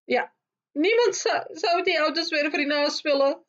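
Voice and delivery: high, strained outburst in character; crying out. A narrator's reading. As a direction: burst out in a high, desperate protest. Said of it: "riep ze uiteindelijk". (hoog, overstuur uitroepen)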